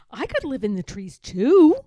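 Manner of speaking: high, loud cub; excited small bear; eager little bear. A narrator's reading. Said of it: "said Little Bear".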